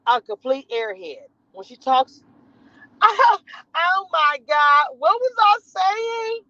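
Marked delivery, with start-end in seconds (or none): 3.0-6.4 higher, slow, ditzy put-on voice; Jasmine voice. Mocking the ditzy star, slow and higher. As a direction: play the confused airhead. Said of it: "I'm doing that voice".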